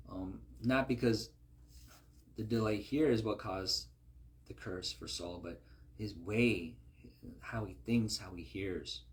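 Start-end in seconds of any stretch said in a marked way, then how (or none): none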